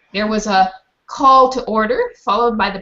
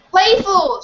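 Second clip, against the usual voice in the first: higher, louder call from the crowd; shouting; 10 semitones higher, 5 dB louder than usual. A narrator's reading. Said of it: "shouted one man".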